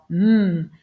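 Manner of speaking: "M" as a long held hum, mmm; isolated phoneme. Shown as a demonstration. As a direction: sound out "M", not the letter name. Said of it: "qui fait le son M".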